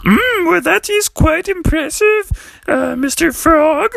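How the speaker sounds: very high, coy pig voice; impressed Piggy. Acted. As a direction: coo admiration in a high diva voice. Piggy admires Daddy Frog's work.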